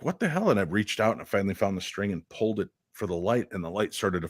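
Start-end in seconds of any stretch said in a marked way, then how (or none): 0.0-0.5 startled, much higher pitch; a jolt of shock, pitched way up; startled outcry. Retold or imitated. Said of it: "was like".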